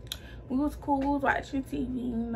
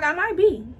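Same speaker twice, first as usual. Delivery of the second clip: a sheepish "I might be", slightly raised; tentative confession; 5 semitones higher, 6 dB louder than usual. Retold or imitated. In the car, telling her mother, sheepish confession. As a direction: slip the news out carefully.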